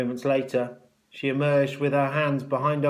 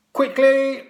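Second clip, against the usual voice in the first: very high, loud command; shouted order; 13 semitones higher, 10 dB louder than usual. A narrator's reading. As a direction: hurry the boy up sharply. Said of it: "ordered Matron".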